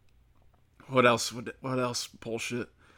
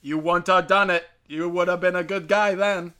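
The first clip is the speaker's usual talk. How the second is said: higher, louder mocking voice of the other side; mocking their logic; 4 semitones higher, 6 dB louder than usual. A podcaster mocking gun-control logic, high and loud sneer. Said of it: "'Cause that's their logic".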